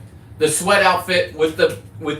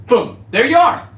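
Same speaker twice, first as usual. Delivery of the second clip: pitched-up, louder boom of triumph; excited reveal; triumphant; 9 semitones higher, 6 dB louder than usual. Real, not acted. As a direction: land it like a reveal. Triumphant after spelling name.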